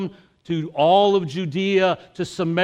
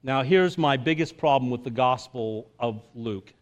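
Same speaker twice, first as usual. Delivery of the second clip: ordinary talk, nothing marked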